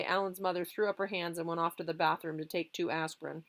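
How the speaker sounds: plain reading voice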